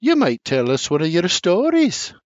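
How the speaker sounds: wife coaxing a story from Senior; coaxing request. A narrator's reading. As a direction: ask him sweetly. Sarah asks Senior.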